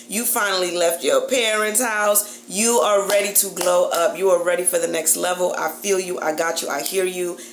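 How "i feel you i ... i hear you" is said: usual speaking voice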